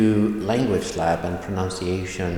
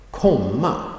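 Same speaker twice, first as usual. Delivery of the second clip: higher, emphatic Komma with a lifted second syllable; stressed word; 5 semitones higher, 4 dB louder than usual. Shown as a demonstration. Teacher isolating the main verb, strong stress on Komma. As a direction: hit Komma as the key word. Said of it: "That gets a stress".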